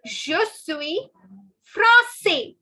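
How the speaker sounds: français ending in an open 'say', last s silent; français said 'frahn-SAY', slow and clear; silent final S. Shown as a demonstration. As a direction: leave the s off the end of français. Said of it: "The last S is not to be pronounced".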